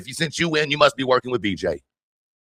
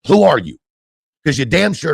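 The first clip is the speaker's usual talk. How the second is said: angry; 4 semitones higher, 4 dB louder than usual. Real, not acted. Angry rant at rival.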